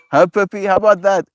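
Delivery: high sing-song dog talk; cooing at her puppy in a high voice. Real, not acted. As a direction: coo at the puppy.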